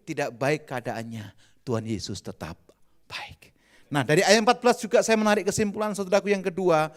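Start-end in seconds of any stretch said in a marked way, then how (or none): 0.0-3.5 soft breathy whisper trailing off; preacher's whisper. Acted. Preacher to congregation about God's goodness, whispered. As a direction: hush it to draw them in.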